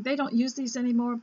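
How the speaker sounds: ordinary delivery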